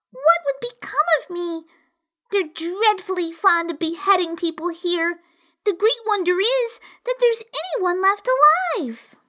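put-on high, anxious little girl; worried girl thinking aloud; young girl voice. A narrator's reading. Alice's thoughts voiced.

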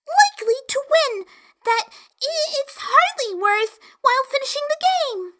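put-on high, hasty girlish cover-up; young girl voice. A narrator's reading. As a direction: quickly cover your words. Alice flatters queen nearby.

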